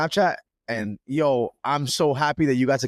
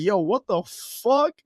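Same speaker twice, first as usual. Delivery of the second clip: drawn-out, high-pitched mock astonishment; stunned disbelief; 6 semitones higher, much slower than usual. Friend retelling his reaction to a stained jersey, stunned disbelief. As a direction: stretch it out, rising on the last word.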